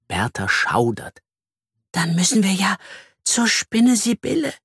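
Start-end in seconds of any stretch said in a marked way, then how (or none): 1.9-4.5 high, fearful whispering; frightened little bee whisper; anxious whisper. A narrator's reading. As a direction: whisper it nervously, pitched up. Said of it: "flüstert sie ängstlich".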